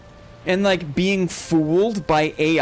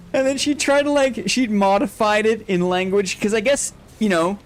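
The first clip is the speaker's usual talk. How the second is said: ordinary talk, nothing marked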